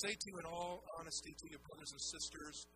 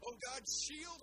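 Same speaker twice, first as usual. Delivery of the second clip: higher and louder cry of Oh God, shield; rising fervent prayer; 6 semitones higher, 4 dB louder, slower than usual. Acted. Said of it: "I pray that prayer in Genesis fifteen one".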